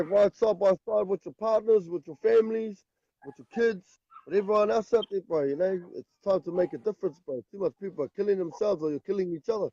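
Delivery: ordinary talk, nothing marked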